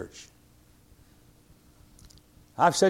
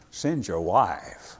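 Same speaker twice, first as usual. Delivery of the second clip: deadpan joke; 8 semitones lower, 4 dB quieter, much slower than usual. Acted. Joke about deacon's vicious wife.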